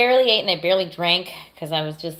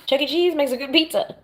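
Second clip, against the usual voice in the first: while sipping a drink; 7 semitones higher than usual. Real, not acted. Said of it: "Sorry, I'm drinking tea".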